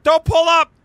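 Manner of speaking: loud, high insistent yell; shout. Real, not acted. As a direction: bellow it, no stopping now. Yelling during the dive.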